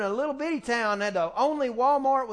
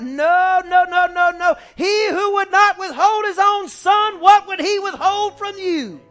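loud, higher, slow proclamation; preaching hard; 6 semitones higher, 10 dB louder, slower than usual. Real, not acted. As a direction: preach it out with full force. Preaching at full force.

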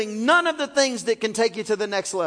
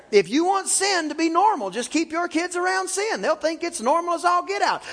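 raised, higher preaching voice; 5 semitones higher than usual. Acted. Preacher pressing his congregation, rising preaching. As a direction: drive the point home from the pulpit. Preacher repeats the point louder.

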